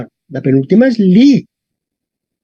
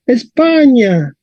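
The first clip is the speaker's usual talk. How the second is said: "España" slowly, stretched on PA; Latin stress kept; 5 semitones higher, much slower than usual. Shown as a demonstration. Teacher showing Spanish keeps the accent, PA stretched in "España". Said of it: "Vemos que en español mantenemos el acento".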